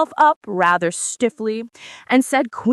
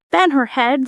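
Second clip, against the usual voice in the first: anxious interruption; 5 semitones higher, 4 dB louder than usual. A narrator's reading. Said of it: "the Red Queen anxiously interrupted".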